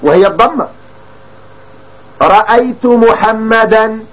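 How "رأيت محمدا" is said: رأيت محمدا said very slowly, the final -an on محمدا sounded out; slow textbook demo of رأيت محمدا with audible fatha; clearly voiced ending. Shown as a demonstration. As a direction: stretch رأيت محمدا and let the -an ending on محمدا ring. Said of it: "تلفظنا بالعلامة أم لا؟".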